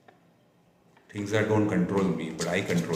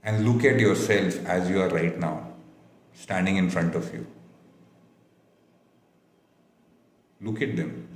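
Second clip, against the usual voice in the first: guided meditation. Acted. Leads a guided visualization.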